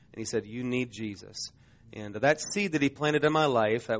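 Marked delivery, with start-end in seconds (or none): 0.5-1.5 softer and slow, earnest pity; a quiet, tender urging; pitying plea. Retold or imitated. Dying friend urges young Patrick to find faith, soft and slow. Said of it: "he looks at me in pity".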